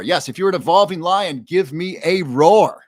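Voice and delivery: loud, high rallying cry, slowing at the end; big playful call to the pride. Pretend. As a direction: call out to rally your lions, stretching the finish. Rallying the viewers to roar.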